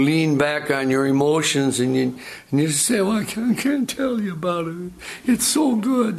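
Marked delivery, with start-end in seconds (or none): 3.0-6.2 high, choked-up testimony; put-on weepy high voice; mock tearful. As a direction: imitate someone overcome with happy tears. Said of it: "why are you crying".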